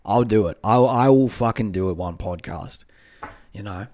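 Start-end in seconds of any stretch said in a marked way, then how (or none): none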